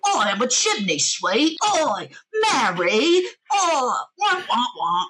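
hammed-up terrible Cockney, high and swooping. Mocking a notoriously bad chimney sweep, exaggerated awful Cockney accent.

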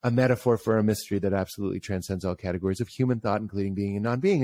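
fast mock recitation; rattled-off definition. Shown as a demonstration. Mocking how long the full definition is, said fast. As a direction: rattle off the whole definition quickly.

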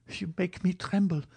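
put-on high, fluttery young woman's reply; fearful. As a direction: confess alarm in a lifted, light voice. Valentine frightened by his proposal.

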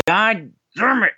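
high, slow, drawn-out groan of defeat; mock exasperation. Friends push him to buy the signed photo, mock exasperated high groan. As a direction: give in to buying, hammed up. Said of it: "And I'm just like".

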